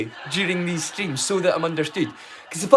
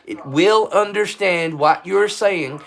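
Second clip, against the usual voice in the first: put-on American accent, leaning southern; 2 semitones lower than usual. Shown as a demonstration. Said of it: "any American accent, it can be from down south".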